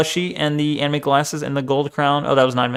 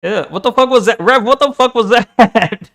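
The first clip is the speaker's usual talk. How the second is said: baffled outburst; 6 semitones higher than usual. Real, not acted. Reacting to Rev's squeaky voice.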